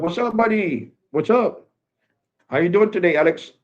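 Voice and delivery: high, bright mimicry of an Indian-accented clerk's greeting; friendly store clerk greeting, lightly imitated Indian accent; Indian accent imitation. Mimicking the seven eleven clerk greeting him, high Indian accent. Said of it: "Just talking with an accent".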